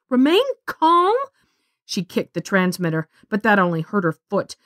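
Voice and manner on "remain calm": incredulous. A narrator's reading. Girl echoes in disbelief.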